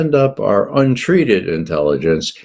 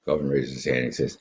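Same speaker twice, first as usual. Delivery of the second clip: normal talking voice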